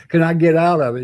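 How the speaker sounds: the classic Navy dodge, played up; typical Navy talk. Sailor showing the crowd typical Navy talk, hammed-up. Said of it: "Typical Navy talk".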